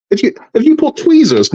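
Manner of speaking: high-pitched, incredulous mock accusation; accusing wife. Wife discovering husband's secret model purchases, mock outraged. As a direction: imitate a wife who's just found the evidence.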